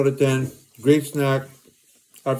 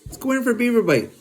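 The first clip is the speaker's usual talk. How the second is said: excited buildup; 8 semitones higher, much faster than usual. Acted. About to bite, hyped.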